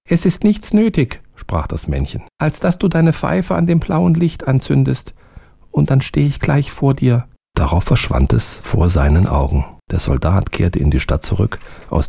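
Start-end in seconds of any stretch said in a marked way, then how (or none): 0.1-1.2 high, small servant voice; eager little servant, high. A narrator's reading. Narrator voices the little man. 2.4-7.3 high, small servant voice; eager little servant, high. A narrator's reading. Held little man voice.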